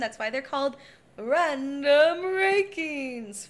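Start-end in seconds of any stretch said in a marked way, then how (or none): 1.2-3.5 very slow, drawn-out title call; show-title announcement. Pretend. Host telling listeners the show's name, drawn-out announcer style. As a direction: stretch each word out like a jingle.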